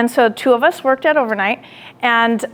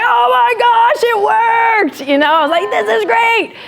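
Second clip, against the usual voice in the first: thrilled; 10 semitones higher than usual. Retold or imitated. After the conflict worked, thrilled high exclamation. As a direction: squeal with joy that it worked. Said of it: "I went away like".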